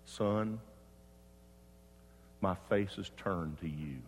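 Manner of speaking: low, soft, spaced-out quote; lightly acted God, hushed and unhurried by pauses. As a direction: answer quietly, leaving a long pause after the first word. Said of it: "he sensed God said".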